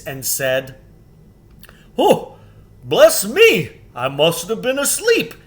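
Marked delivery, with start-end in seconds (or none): 2.0-5.3 loud, much higher astonished giant; a big good-natured giant just woken; waking giant. Acted. The giant waking from stone, loud and astonished.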